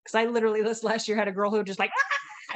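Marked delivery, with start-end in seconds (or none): 2.0-2.5 imitated attention scream. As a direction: imitate a girl's squeal for attention. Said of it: "she'd make this screaming sound".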